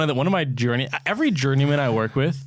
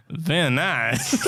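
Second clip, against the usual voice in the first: slow mock-Kazakh catchphrase; drawn-out comic foreign accent; Borat; much slower than usual. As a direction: imitate a coworker's Borat, stretching the words. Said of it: "he'll be like".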